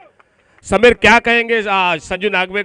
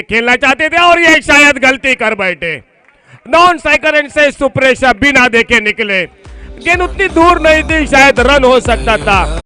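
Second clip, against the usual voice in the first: excited cricket commentary; 6 semitones higher, 5 dB louder than usual. Real, not acted. Wicket falls, live commentary.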